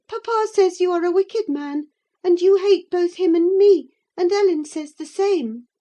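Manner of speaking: put-on high girlish refusal; defiant young lady. Young lady refusing to speak to the rider, defiant.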